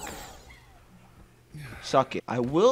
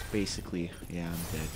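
ordinary delivery

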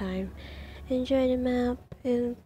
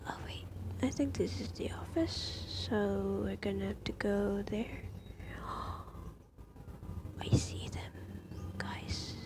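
whispered fear; 6 dB quieter than usual. Real, not acted. Exploring a dark horror map.

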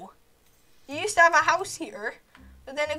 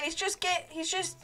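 ordinary talk, nothing marked

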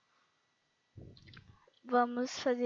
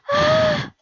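a short, sharp, high scream; 12 semitones higher, 13 dB louder than usual. Real, not acted. Gamer girl screaming as her character falls. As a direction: let out a startled shriek.

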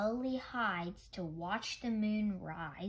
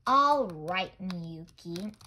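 sighing; 3 semitones lower, 8 dB louder, slower than usual. A narrator's reading. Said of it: "Grandfather sighed".

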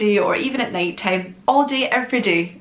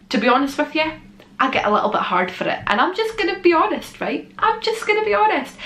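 high excited; 8 semitones higher than usual. Real, not acted. High pitched emphatic honesty.

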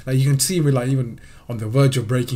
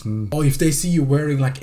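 normal talking voice

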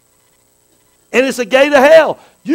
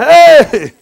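shout; 5 semitones higher, 9 dB louder than usual. Retold or imitated. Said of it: "We're going".

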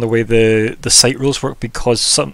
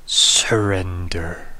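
slow, drawn-out rasping whisper; raspy voice; 5 semitones lower, much slower than usual. Acted. Game master voices the wounded enemy giving in, raspy. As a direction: rasp it out low and very slowly. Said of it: "the raspy voice".

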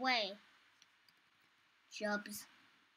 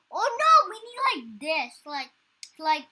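ordinary talk

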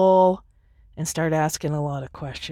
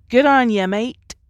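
bad Australian accent, higher and louder; 6 semitones higher, 7 dB louder than usual. A podcaster trying an Australian accent, clumsily. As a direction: toss off a clumsy Australian "good on ya". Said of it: "I can't do an Australian accent".

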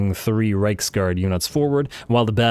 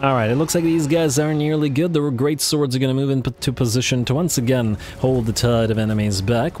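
ordinary talk, nothing marked

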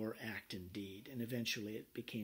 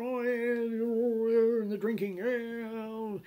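high, loud boozy cheer; rowdy tavern revelry; merry pub drinkers; 9 semitones higher, 9 dB louder, much slower than usual. Retold or imitated. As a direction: ham up happy drinkers in a pub.

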